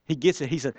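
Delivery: usual speaking voice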